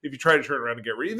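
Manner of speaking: normal talking voice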